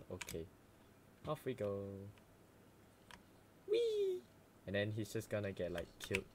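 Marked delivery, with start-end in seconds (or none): 3.7-4.2 gleeful squeal. Real, not acted. Gleeful run.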